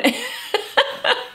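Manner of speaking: high giggle after her own impression; laughing. Real, not acted. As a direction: crack up at your own mock yell. Laughs at own mock yell.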